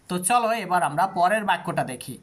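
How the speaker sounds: normal talking voice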